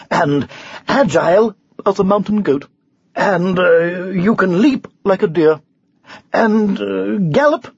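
eager, lively knight piling on praise; admiring knight. Acted. Knight praises the unicorn's speed and agility, eagerly flattering. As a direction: flatter with mounting enthusiasm.

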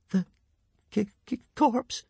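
high, halting stammer; frightened stutter. A narrator's reading. Hiccup seeing the corpse's finger twitch, frightened stutter. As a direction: stutter it out in alarm. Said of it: "stuttered Hiccup".

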